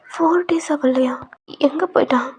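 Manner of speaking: hushed stunned question; shocked whisper. Acted. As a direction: breathe the words out in shock. Shocked question about missing man.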